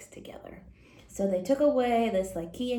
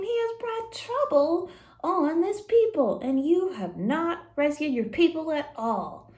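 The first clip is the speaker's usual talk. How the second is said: higher, louder pleading cry to God; anguished plea; 8 semitones higher, 4 dB louder than usual. Acted. Moses crying out to God.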